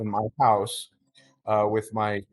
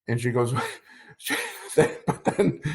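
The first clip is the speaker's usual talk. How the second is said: real laughter breaking through the words; chuckling mid-sentence at the memory; laughing. Real, not acted. Laughs while retelling wife's reaction.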